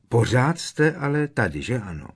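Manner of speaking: high, forceful opening; insistent. A narrator's reading. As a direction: push for agreement. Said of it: "dorážel na něj Harry".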